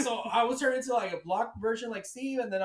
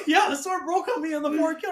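ordinary talk

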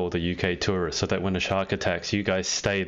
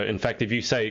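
usual speaking voice

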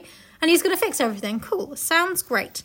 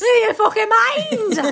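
high, slow, louder indignant protest; hammed-up offended snap; mock outrage; 10 semitones higher, 8 dB louder, much slower than usual.

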